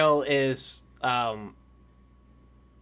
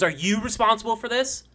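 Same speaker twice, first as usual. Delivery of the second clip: raised, agitated accusation; freaked-out questioning, pitched up; freaking out; 8 semitones higher, 12 dB louder than usual. Acted. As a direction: demand an answer in rising alarm. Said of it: "freaking out".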